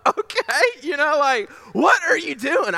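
high-pitched, bewildered protest; mock incredulity. Employee told his paycheck is ending, mock incredulous. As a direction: act out stunned disbelief for laughs.

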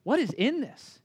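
surprised mom. Retold or imitated. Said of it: "and she goes".

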